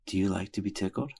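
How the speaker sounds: mock whisper. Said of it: "and whisper".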